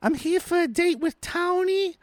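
high, slow fake voice; put-on girly voice. Woman at the mob hideout asking for her date, fake voice. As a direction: coo it slowly in a high put-on voice. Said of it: "she puts her voice on again".

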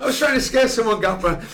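high, sheepish, caught out; sheepish excuse. Retold or imitated. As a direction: plead like a caught schoolboy. Player's sheepish excuse, retold.

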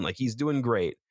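ordinary talk, nothing marked

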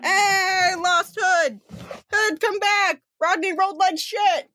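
excited shout. Real, not acted. Game master yelling as the players fail their dice rolls. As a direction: yell out at the bad roll.

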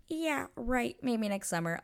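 laugh, then a high dismissive brush-off; scoffing Kate. Retold or imitated. Kate turning Anthony away at the house, scoffing. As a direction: scoff it, starting high and dropping. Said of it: "Kate's like".

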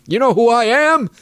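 mock Brooklyn accent, pompous; pedantic Brooklyn accent. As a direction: sneer it like a Brooklyn hotshot. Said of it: "got this pedantic Brooklyn accent".

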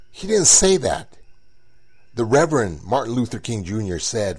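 normal talking voice

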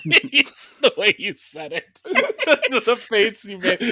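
laughing hard through the words, high and loud; can barely get it out for giggling. Real, not acted. Laughing at friend's delivery.

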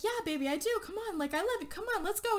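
high, gentle imitated pleading; lightly voiced boyfriend coaxing his love. Shane coaxing Rachel out of the bathroom, high and gentle.

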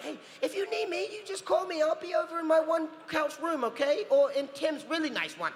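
high, loud call across the set; friendly yelled offer. Retold or imitated. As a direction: shout it out cheerfully to the crew. Calling out to crew, shouting.

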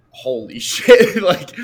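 loud, slow, laughing disbelief. Retold or imitated. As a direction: laugh the words out in shock. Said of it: "I was like".

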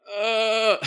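high, loud groan of dismay. Retold or imitated. Patient told by the nurse he can't drink, loud dismayed groan. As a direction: moan like the worst news ever.

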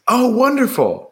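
very high, delighted oblivious uncle; Uncle Desmond. Retold or imitated. Uncle hearing they are pregnant, high smiling delight. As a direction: imitate a clueless uncle cooing with a smile. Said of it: "always there and always smiling".

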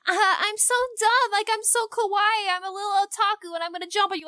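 very high, squeaky weeb voice; ditzy high-pitched fangirl act; squeaky otaku girl. Retold or imitated. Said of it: "popular back then to just kind of".

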